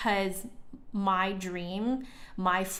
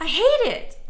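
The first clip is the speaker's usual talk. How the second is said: annoyed outburst; 12 semitones higher, 10 dB louder than usual. Real, not acted. Annoyed by misreading commenters.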